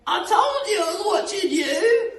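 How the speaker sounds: loud, very high gloating screech; Missus Twit cackling. Acted. Missus Twit after her glass eye trick, high cackling. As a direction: cackle it triumphantly in falsetto. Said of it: "cackled Missus Twit".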